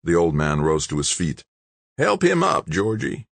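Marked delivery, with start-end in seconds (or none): 2.0-3.3 high grinding rasp, dropping on the name; raspy old man. A narrator's reading. Old man giving Big George an order, raspy. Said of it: "His voice sounded like an old-time diesel rig".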